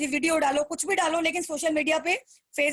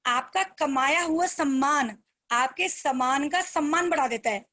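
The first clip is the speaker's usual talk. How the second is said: slow key line. Shown as a demonstration. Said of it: "ध्यान से सुनना".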